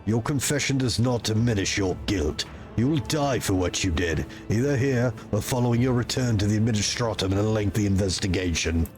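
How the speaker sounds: loom over him with cold authority; stern commander voice. Acted. Player character voice.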